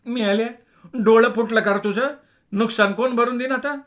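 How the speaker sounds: high, loud rustic snapping; snapping milkmaid. A narrator's reading. The milkmaid snaps at the cyclist who knocked her down. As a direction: bite his head off. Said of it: "खेकसली".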